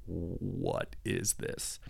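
soft, slow, awed question; awed hush. Retold or imitated. Asking himself inside the vision, hushed and slow. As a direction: barely voice it, lost in wonder.

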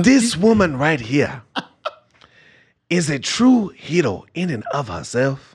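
a light Wakandan king voice. Acted.

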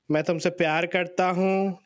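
rattled off very fast; rushed Hindi. Retold or imitated. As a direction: gabble the Hindi phrase quickly. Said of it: "like a hundred and fifty syllables".

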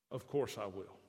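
low, soft assurance; gentle reply. Acted. Jesus gently reassuring the leper, low and soft. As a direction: reassure him quietly, deep and kind.

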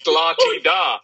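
mock la-di-da. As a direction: needle him about his fancy box seats. Teasing friend about box seats.

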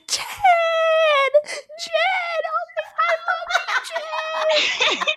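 high, loud fangirl shrieks through tears; screaming fan. Retold or imitated. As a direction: scream for the idol in ecstatic tears. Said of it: "Old girl was like".

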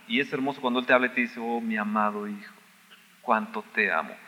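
tender. Acted. God's loving words voiced softly.

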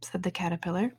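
ordinary delivery

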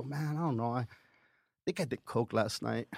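low, hushed reluctant confession; soft, sheepish admission; remorseful. Retold or imitated. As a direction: own up quietly, full of regret. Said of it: "To hear the remorse in your fucking voice".

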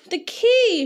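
high, drawn-out cry falling at the end; excited shout. A narrator's reading. Bilbo shouting to Thorin as he hurries up, high urgent shout. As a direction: shout it urgently, pitched well up. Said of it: "shouted Bilbo".

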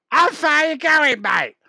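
high-pitched, loud holler; ticket inspector's shout. Acted. Ticket inspector bellowing at a passenger, comic high shout. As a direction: holler it far too high, falling at the end. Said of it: "Like that".

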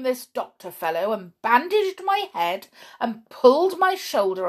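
loud complaint climbing in pitch; indignant. A narrator's reading. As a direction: build the grievance to a high wail. Perry's indignant account of mistreatment.

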